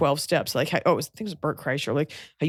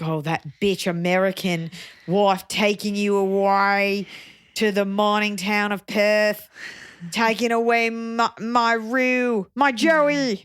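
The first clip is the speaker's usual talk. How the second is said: slow Australian mother-in-law lament, rising higher; hammed-up Australian accent, drawn out; 3 semitones higher, slower than usual.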